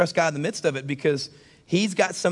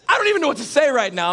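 high, loud comic disbelief; hammed-up frustration; exasperated; 10 semitones higher, 6 dB louder than usual. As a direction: sputter as if lost for words. Acting out his exasperation.

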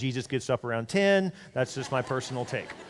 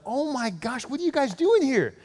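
high-pitched, swooping astonishment; surprised greeting; 8 semitones higher, slower than usual. Acted. Pastor to old friends unseen for years, surprised. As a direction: exclaim at seeing long-lost friends.

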